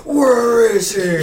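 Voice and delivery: shouting. Acted. Shouting in the chaos.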